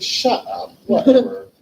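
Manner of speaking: mock disbelief. Disbelief at friend coming out.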